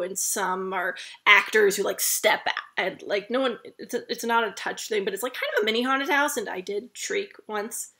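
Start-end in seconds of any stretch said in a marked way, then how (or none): none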